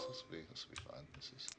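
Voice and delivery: whispered aside to himself; whispering. Real, not acted. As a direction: breathe it under the breath. GM muttering aside to self.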